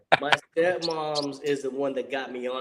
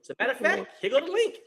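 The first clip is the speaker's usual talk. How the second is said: higher, laughing retold invite; lightly voiced buddy, higher and amused; imitating his offer; 10 semitones higher than usual. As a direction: toss off the invite pitched up with a chuckle. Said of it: "He's like".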